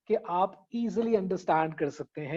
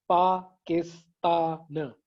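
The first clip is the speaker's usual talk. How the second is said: Pakistan said very slowly, split into syllables; counting syllables; much slower than usual. Shown as a demonstration. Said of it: "चार syllables हैं". (पाकिस्तान को धीरे धीरे syllable में तोड़कर बोलना)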